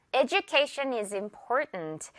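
"Education" said eh-joo-KAY-shun, slowly; j sound in education. Shown as a demonstration. Teacher modelling the example sentence, j sound. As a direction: stretch "Education" out with a j for the d. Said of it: "edu 아니고 주라는 거".

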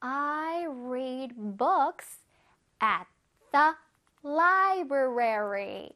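I read books at the library, every word drawn out; slow example sentence. Shown as a demonstration. Teacher reading an example sentence slowly to kids. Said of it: "우리 친구들 차례".

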